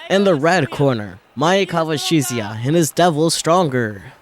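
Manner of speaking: loud, high ring announcer call. Acted. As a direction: boom it out over the arena. Ring announcer introduces fighters.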